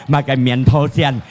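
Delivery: ordinary talk, nothing marked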